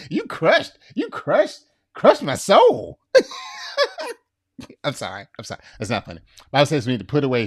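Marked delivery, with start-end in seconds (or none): 0.1-3.0 high, hurt complaint; mock wounded. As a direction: whine like your soul is crushed.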